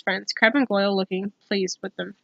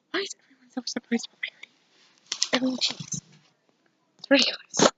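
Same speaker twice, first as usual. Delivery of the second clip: whispered aside; 2 semitones higher, 6 dB quieter than usual. Real, not acted. Reader grumbling to the listener about the story, whispered. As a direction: lean in and whisper a quick complaint.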